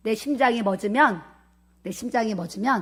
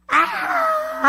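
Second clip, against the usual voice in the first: very high, long scream; re-enacted waking scream. Preacher acts out waking when soul returns, high scream. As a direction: scream out as if jolted back to life. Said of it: "하고 소리 지르고 일어났다니까요".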